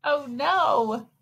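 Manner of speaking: ordinary delivery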